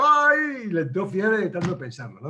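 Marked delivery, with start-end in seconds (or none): 0.0-0.6 high, loud fretful "ay"; a worried mother-in-law's cry; sudden high "ay" of worry. Acted. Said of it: "y diciendo".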